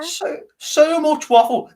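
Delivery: slow, high, dragged-out scorn; scornful dismissal. Real, not acted. Talking to his co-host about transfer rumours, slow, high scorn. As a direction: sneer at the whole story, drawn out. Mocking the Rodriguez story.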